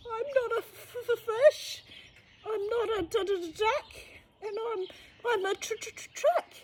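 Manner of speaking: high, stammering, gasping voice; choking, stuttering truck. Acted. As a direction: choke on each word in panic. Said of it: "he gasps".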